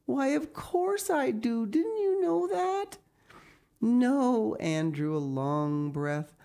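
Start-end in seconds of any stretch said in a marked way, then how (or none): 0.0-3.0 put-on very high girlish voice; Diana, surprised. Diana assures Anne of her love, surprised high voice. 3.8-4.5 high, loud, stretched-out denial; Anne, awed. Acted. Anne had never known she was loved, awed high voice.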